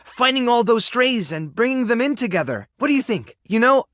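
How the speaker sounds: high, eager and happy; upbeat, a laugh near the end; excited. Acted. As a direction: pitch the idea with rising delight. Pitching team idea, thrilled at yes.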